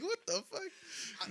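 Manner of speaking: high, slow, drawn-out incredulity; laughing disbelief. Retold or imitated. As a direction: stretch it out high through laughter. Laughing disbelief at friend's boast.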